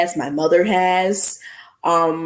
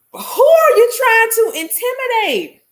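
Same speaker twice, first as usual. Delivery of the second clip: indignant challenge; 4 dB louder than usual. Retold or imitated. Retold standoff with transit cop.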